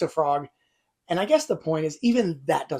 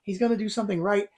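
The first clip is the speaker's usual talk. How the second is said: higher, eager hopeful voice; hopeful excitement; 6 semitones higher than usual. Retold or imitated. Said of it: "And you're like".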